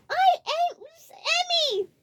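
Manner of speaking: very high, loud; frightened cry; panicked. A narrator's reading. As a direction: force out the words in panic. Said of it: "a look of panic".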